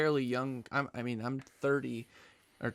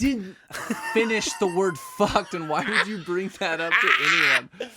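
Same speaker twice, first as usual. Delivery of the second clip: laughing; 6 semitones higher, 6 dB louder than usual. Real, not acted. Embarrassed laughing defense.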